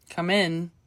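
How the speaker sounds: ordinary delivery, nothing marked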